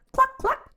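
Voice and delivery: high, loud clucking; chicken clucks. Acted. Reading to kids, the chicken clucks at Duck. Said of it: "said Chicken".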